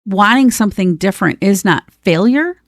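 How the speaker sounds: normal talking voice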